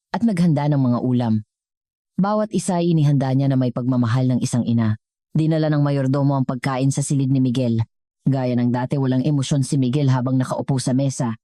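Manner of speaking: ordinary delivery, nothing marked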